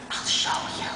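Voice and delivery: a whispered, conspiratorial offer; sly whisper. Retold or imitated. Imitating the co-star's secret offer, sly whisper. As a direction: breathe it like a secret dare. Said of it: "And he went".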